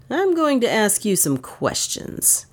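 slow, mildly menacing warning. A narrator's reading. Detective about to question the niece, slowly menacing. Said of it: "he said menacingly to Dale".

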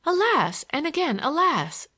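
high, swooping cry of alas; young lady's high exclamation; exclaimed lament. A narrator's reading. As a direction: lament theatrically, pitch well up. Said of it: "exclaimed Olivia".

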